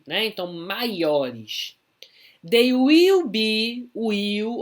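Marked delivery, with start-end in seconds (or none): 2.5-4.6 slow, loud model sentence for the class; slow model reading. Shown as a demonstration. Teacher reading an example sentence aloud to students, slow and loud. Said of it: "Ó, olha o be aqui".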